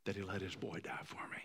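hushed, choked whisper; whispered in emotion. Real, not acted. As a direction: breathe it out, moved. Moved, hushed confession.

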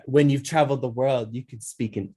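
put-on grand socialite; Dorit's tagline. Guest quoting Dorit's show intro, mock grand. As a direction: deliver it as a showy tagline. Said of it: "one of those intro talking ones".

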